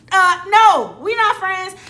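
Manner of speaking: loud, high-pitched flat-out refusal; indignant rebuff. Acted. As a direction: shut the idea down with force. Indignant rebuff of the other woman.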